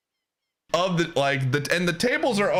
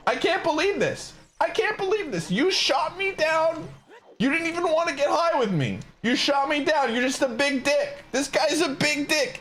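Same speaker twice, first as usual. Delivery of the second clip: higher mock drunk whine, repeating herself; imitating drunk woman; 11 semitones higher than usual. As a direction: play the tipsy stranger, pitched up and insistent. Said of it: "just continues to repeat to me".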